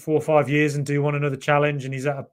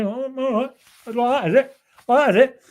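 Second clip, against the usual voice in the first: mock indignant retort; 10 semitones higher, 6 dB louder than usual. Mock retort to sulking fiancée.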